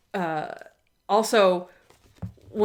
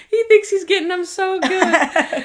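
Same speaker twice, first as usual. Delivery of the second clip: laughing; 10 semitones higher, 6 dB louder than usual. Real, not acted. Laughing at the insults.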